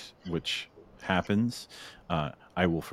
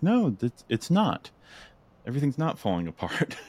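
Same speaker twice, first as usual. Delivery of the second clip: firm reassurance; 6 semitones higher than usual. Pretend. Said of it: "It's like".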